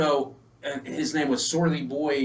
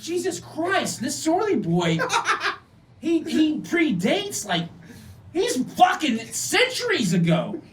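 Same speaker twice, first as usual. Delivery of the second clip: amazed disbelief; 10 semitones higher, slower than usual. Retold or imitated. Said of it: "I'm sitting there going".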